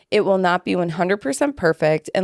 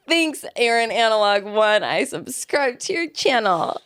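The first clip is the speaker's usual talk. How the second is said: hammed-up thanks; 4 semitones higher than usual. Host parodies her own repeated thanks to a commenter, hammed-up.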